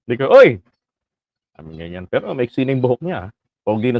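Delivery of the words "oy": a quick, bright shout of hey; Bisdak's hearty greeting shouted at JDB; mock call out. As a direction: call out to a friend across the crowd.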